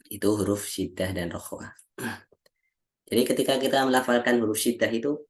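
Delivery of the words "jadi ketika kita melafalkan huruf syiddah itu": usual speaking voice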